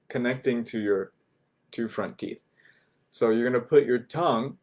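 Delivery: ordinary talk, nothing marked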